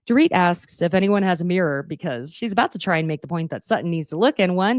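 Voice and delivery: ordinary delivery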